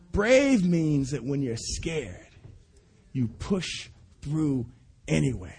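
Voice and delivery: slow, dropping to soft at the end; slow fading emphasis. Real, not acted. Preacher repeats the lesson to people, slow and fading. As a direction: let it sink in quietly. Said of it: "third take, slower, fading".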